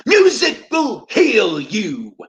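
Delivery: mock faith healer hitting the big line; preacher's healing shout. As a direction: stretch out "Musical" like a tent-revival preacher. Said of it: "The Reverend Peace is about to broadcast".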